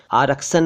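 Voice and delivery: आरक्षण said as "aarak-san"; plain s for sh in आरक्षण; mispronounced आरक्षण. Shown as a demonstration. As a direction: turn आरक्षण into आरक्सन. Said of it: "आरक्षण नहीं".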